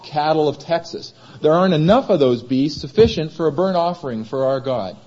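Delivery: ordinary talk, nothing marked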